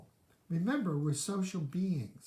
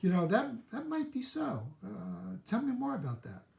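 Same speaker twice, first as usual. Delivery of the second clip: much higher, open and interested; curious; 5 semitones higher than usual. Acted. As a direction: lean in, invite them to say more. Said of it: "or do you have some curiosity?".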